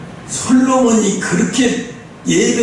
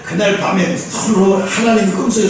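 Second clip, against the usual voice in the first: ordinary delivery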